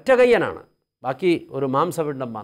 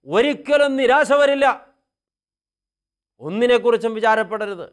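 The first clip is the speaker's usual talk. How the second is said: fervent preaching; 10 semitones higher, 6 dB louder than usual. Acted. Priest urging the congregation never to despair, loud and fervent. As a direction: preach it out with full conviction.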